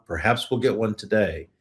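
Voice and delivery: usual speaking voice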